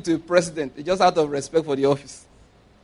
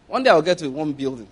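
ordinary talk, nothing marked

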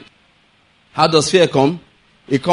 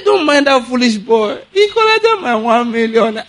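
put-on offhand old man, lightly higher and slow; casual rich father; 7 semitones higher than usual. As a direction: shrug off a million like small change. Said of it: "the man just said it casually".

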